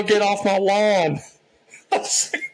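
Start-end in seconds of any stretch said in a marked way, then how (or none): 0.0-1.2 put-on high, louder, slow grumpy old man yell; mock grumpy yell. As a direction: yell it like a cranky old man on his porch. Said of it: "waiting on the yell".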